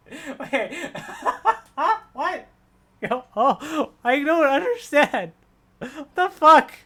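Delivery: high, loud, laughing shock; cracking up in disbelief. Real, not acted. Shocked reading the message, laughing.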